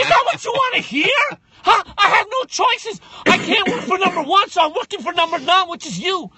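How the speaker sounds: high, loud yelling; shouting. Pretend. Guest yells the answer at an imagined interviewer. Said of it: "mock answer to interviewer".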